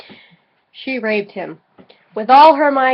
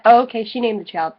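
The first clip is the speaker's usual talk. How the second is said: ordinary delivery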